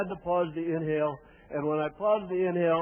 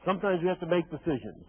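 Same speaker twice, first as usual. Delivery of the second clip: ordinary talk, nothing marked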